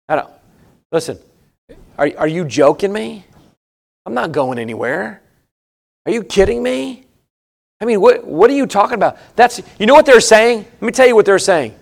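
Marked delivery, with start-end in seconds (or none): 0.1-9.2 mocking dismissal, softer and offhand; scoffing sons-in-law. Sons-in-law laughing off Lot's warning, soft and scoffing. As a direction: brush off the old man's warning.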